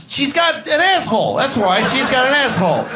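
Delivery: high, exasperated comic shout; indignant retort. Acted. Acted quarrel between ducks.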